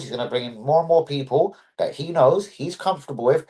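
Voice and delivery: ordinary talk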